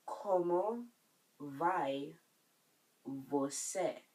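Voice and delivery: "Como vai você?" with a pause after each word. Shown as a demonstration. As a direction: separate every word for learners. Teacher breaks phrase up for learners.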